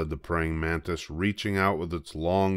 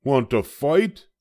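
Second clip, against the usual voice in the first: high, loud, grumpy little bug; a tiny bug spoiling for a fight, high and loud; grouchy ladybug; 8 semitones higher, 6 dB louder than usual. A narrator's reading. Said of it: "said the grouchy ladybug".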